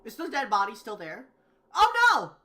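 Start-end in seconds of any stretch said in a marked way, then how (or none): 1.8-2.3 sudden high alarmed cry; shocked gasp. Acted. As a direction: jump up in panic. Finds body missing.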